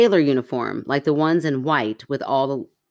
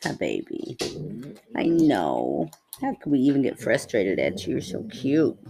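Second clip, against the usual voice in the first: lower, soft pet talk; cooing to dog; 3 semitones lower, 4 dB quieter than usual. Real, not acted. Host coos to her dog while others wait, soft pet talk.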